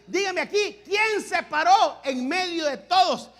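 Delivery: fiery preaching. Acted. Pastor challenging his congregation about the ship, fiery preaching. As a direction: press the congregation with each question.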